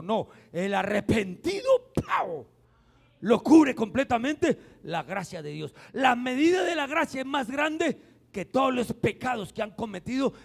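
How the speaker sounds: loud, slow, rising sermon cry; fiery pulpit preaching; preaching at full force. Acted. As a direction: hammer the point home to the congregation. Preaching builds on grace.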